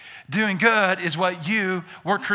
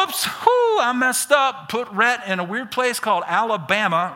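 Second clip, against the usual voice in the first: mock flustered God; 4 semitones higher than usual. Pastor voicing a panicked God, hammed-up. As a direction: play God fumbling in a panic. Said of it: "God didn't go".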